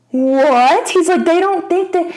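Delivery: mock alarm, voice jumping up; freaking out. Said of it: "he's, like, freaking out".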